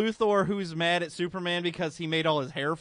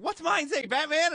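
high mock Robin; 10 semitones higher, slower than usual. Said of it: "he's like".

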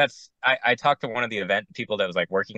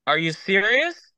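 disbelief; 11 semitones higher, slower than usual. Retold or imitated. Reacting to coordinator's news.

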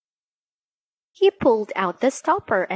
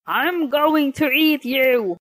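menacing shout; 5 semitones higher than usual. Acted. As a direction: roar it like a hungry genie. Genie threatens the fisherman.

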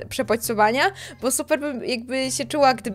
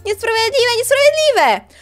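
wysoko, z narastającą głośnością; dziecinny protest; 10 semitones higher, 4 dB louder than usual. Acted. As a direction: tupnij nogą jak obrażone dziecko. Duch nie może spróbować. (wysokie, coraz głośniejsze „niesprawiedliwe”)

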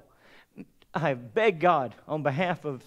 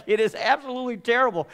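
a touch of crying, pitched up; tearful despair; 5 semitones higher, 3 dB louder than usual. Retold or imitated. Pastor retelling telling his friend, tearful despair. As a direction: let it crack with grief. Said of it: "I was like".

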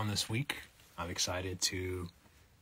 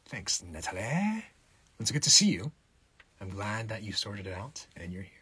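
ordinary talk

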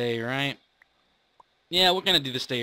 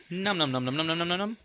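eating noises; 4 semitones higher, faster than usual. Pretend. Eating bread in game.

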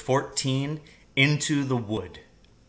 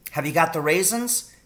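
put-on lightly raised eager boy voice; a young son, keen and ready; boy's voice; 6 semitones higher than usual. A narrator's reading. Danny tells his father he is ready, in a lightly raised boy voice.

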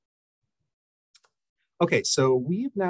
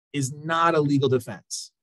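usual speaking voice

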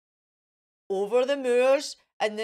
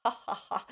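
soft cackling ha ha ha; witch's laugh; 5 semitones lower, 7 dB quieter, much faster than usual. A narrator's reading. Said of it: "The witch laughed aloud".

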